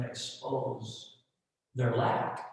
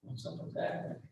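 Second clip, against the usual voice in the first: whispered, puzzled aside; whispered bystander's doubt; skeptical mutter; 11 dB quieter than usual. Retold or imitated. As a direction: mutter it under your breath, unconvinced. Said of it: "everybody else is kinda sitting there going".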